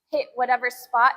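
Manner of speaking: ordinary delivery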